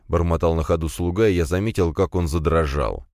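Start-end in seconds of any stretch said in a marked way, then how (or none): none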